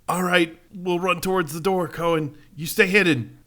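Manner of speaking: high, urgent NPC call; urgent soldier voice. Acted. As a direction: hurry them off as a soldier.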